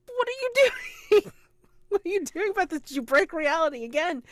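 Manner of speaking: a frantic fiancée demanding answers; freaking out. Retold or imitated. Fiancée panicking at Victor as the library maelstrom starts, high frantic voice. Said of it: "she's, like, freaking out".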